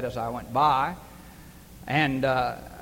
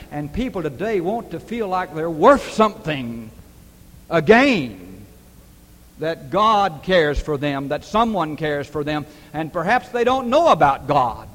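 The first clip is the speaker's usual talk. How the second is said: louder, driving preacher's cadence; preaching at full force; 2 semitones higher, 10 dB louder than usual. Acted. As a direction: build the sermon to a ringing climax. Sermon peak, loud appeal.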